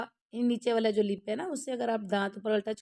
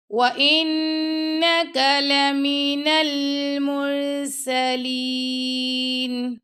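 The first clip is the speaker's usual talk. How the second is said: slow, melodic tajweed chant; teacher chanting a verse for students; Quran recitation; 4 semitones higher, 5 dB louder, much slower than usual. Shown as a demonstration. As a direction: recite it slowly with full tajweed.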